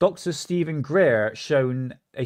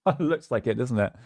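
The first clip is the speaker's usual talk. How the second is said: ordinary talk, nothing marked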